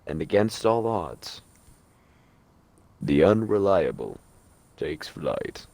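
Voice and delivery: lightly put-on seventies announcer, dropping low at the end. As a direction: build up, then land it low like an announcer. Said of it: "In, like, a seventies announcer voice".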